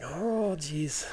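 dreading. Retold or imitated. Dreading asking the boss for leave.

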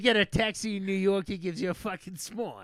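joking New York street voice; mock New York accent. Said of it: "greatest Northeast fucking comparison".

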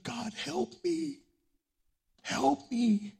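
acted plea of a hurting man; pleading prayer. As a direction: plead with God from a broken place. Voicing a broken person's plea to God.

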